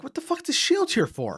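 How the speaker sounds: high-pitched mock bafflement; incredulous reaction. Retelling his reaction to the counter shield, incredulous.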